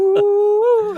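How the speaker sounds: very high, loud mock "ooh"; a squealing teasing "ooh"; joking at a noise, high teasing squeal. Joking reaction, high cry.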